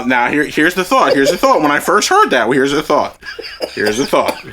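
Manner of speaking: ordinary delivery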